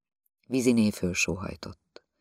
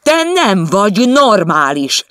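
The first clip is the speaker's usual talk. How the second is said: higher, much louder, slow shout on nem; 10 semitones higher, 12 dB louder, slower than usual. Acted. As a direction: yell it at her this time. He repeats it in the quarrel. (kiabálva, lassan, a nem szóra nyomva)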